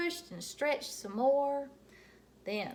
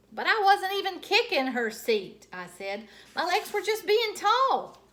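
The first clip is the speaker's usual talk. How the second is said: high, loud indignant whine; protesting kid; 10 semitones higher, 11 dB louder than usual. Acted. Junie B protesting to Mother, high and indignant. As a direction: insist you did nothing wrong.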